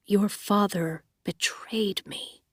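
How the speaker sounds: slow, hushed, near-whispered calm; calm her like he did when she was small; low soothing voice. Acted. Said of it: "speaking in the low, soothing voice".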